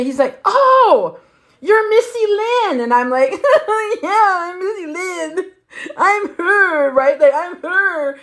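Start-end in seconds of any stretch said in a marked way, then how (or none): 0.4-2.8 high, loud, slow dawning oh; surprised recognition. Retold or imitated. Tattoo artist realizing who she is, surprised and high. 3.3-4.0 high giggle. Retold or imitated. Laughs in high voice. 4.0-8.2 high, slow, half laughing half sobbing; laugh-crying admission. Retold or imitated. Admitting to the artist she is Missy Lynn, laugh-crying.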